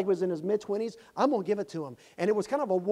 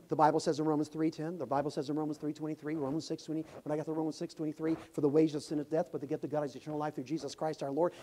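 fast, softer run through the verses; rattling off verses; 3 semitones lower, 5 dB quieter, faster than usual. Retold or imitated. Preacher rattling off Romans verses to him, fast. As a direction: rush through the scriptures in one breath. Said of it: "I was going about that fast".

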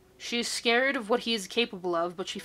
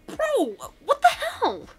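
high, slow, stretched disbelief; shocked bro voice; 4 dB louder, slower than usual. Acted. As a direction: play the stunned friend. Best friend reacting, voiced.

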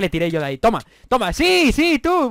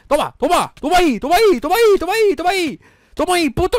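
higher, louder gleeful yells; excited taunting; 9 semitones higher, 8 dB louder than usual. Real, not acted. Gamer hitting another player, louder and higher taunting yells. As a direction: whack them and yell with glee.

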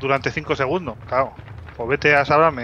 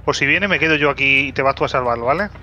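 normal talking voice

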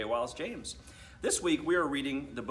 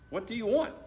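high, louder irritated snap; snapping; 11 semitones higher, 5 dB louder than usual. Acted. Annoyed boy snapping at his sister, high irritated snap. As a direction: bite her head off. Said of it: "he snapped".

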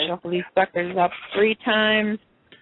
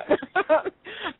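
giggle; 11 semitones higher than usual. Real, not acted. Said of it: "I might giggle or laugh".

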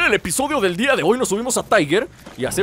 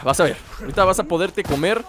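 ordinary talk, nothing marked

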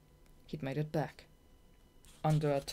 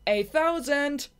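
high, loud, slow triumphant bellow; 12 semitones higher, 11 dB louder, much slower than usual. Acted. Shade announcing his win, high loud bellow. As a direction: roar the number in triumph. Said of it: "he bellowed a minute later".

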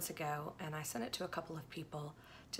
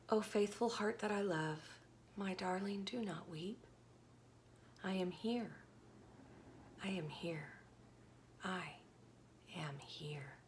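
soft, hushed comfort fading away; gentle whispered reassurance, much softer; tender whisper; 7 dB quieter than usual. Acted. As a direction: soothe a weeping loved one. God's tender reply.

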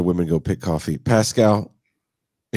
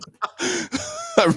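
high burst of laughter; real laugh. Real, not acted. Host laughing at the Pétion story, high laugh. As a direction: crack up at the joke.

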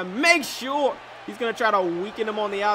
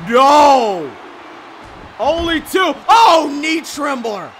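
excited shout; 6 semitones higher, 11 dB louder, slower than usual. Real, not acted. Near fall in the match.

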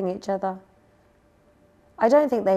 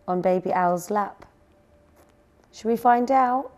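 ordinary delivery, nothing marked

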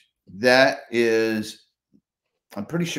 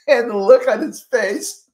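high-pitched, words bubbling with laughter; laughing; 12 semitones higher, 3 dB louder than usual. Real, not acted. Speaker laughing about the judge's face, much higher pitch. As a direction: tell it while laughing.

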